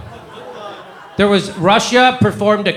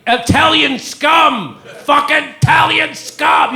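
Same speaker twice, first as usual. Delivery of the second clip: slow, drawn-out mock yell, much higher; mock scream; 10 semitones higher, slower than usual. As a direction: ham up the outraged anchor screaming scum. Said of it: "you couldn't say".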